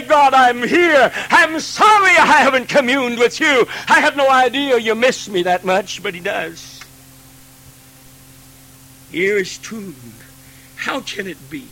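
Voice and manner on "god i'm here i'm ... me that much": louder, earnest confessing prayer; a believer pouring out an apology to God; repentant prayer. Acted. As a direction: believer in his car praying, loud and earnest. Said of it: "stand in our car and say".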